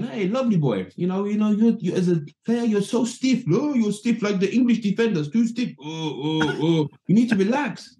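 high, teasing manager voice; hammed-up Ruud telling him he's stiff; imitating Ruud Gullit. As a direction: manager needling the young winner at the ceremony. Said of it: "Ruud's going".

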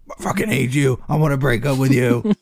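high, put-on voice of a spiteful phone; mock angry phone voice. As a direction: sneer it out as the rude phone. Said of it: "the phone's like".

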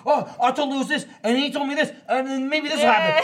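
high-pitched giddy mimicry; imitated freak-out. Host imitating friend freaking out in the car, excited. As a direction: run through it in a high, overexcited voice. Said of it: "freaking out in the car".

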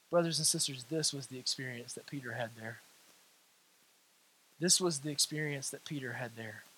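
very low, very soft, solemn delivery; hushed preaching. Real, not acted. Preacher tells brothers and sisters of Peter's experience, hushed preaching. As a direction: drop right down and leave space between phrases.

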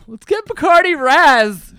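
high, loud, slow bro voice; party bro voice. Retold or imitated. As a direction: hype up the booze like a teen. Quoting high school drinkers.

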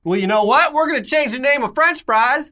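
high, loud huffy announcement; petulant politician voice; pompous official. Pretend. Voicing American officials, mocking.

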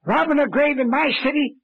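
high-pitched indignant bluster; scandalised official flying off the handle; outraged. Acted. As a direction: explode in indignation, voice shooting up. Mayor outraged, told to take it easy.

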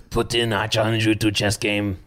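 put-on Russian accent, drawn out. Shown as a demonstration. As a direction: issue a slow challenge in a Russian accent. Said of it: "Tell him to do an accent".

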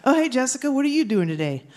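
lightly acted chirpy ventriloquist hello; puppet comic. Shown as a demonstration. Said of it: "the beloved puppet comic".

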